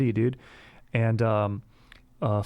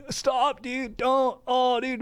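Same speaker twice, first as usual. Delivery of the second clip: much higher, squeaky pleading; high whiny protest; 13 semitones higher, much slower than usual. Retold or imitated. Pinned kid begging brother to stop, high whine. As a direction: whine to make him stop. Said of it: "I was like".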